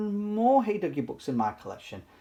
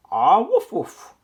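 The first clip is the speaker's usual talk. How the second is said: loud, higher playful woof; dog bark; 4 semitones higher, 9 dB louder, slower than usual. Acted. Duggee barks to stop the squabbling squirrels, playful woof. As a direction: bark like a friendly big dog.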